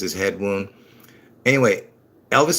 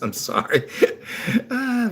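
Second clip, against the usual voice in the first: laughing; 12 semitones higher, 4 dB quieter than usual. Real, not acted. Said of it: "I can't even speak 'cause of my dentures".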